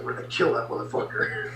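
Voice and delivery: whispered threat, dead serious; menacing whisper. Retold or imitated. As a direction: hiss the threat under your breath. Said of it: "He's like".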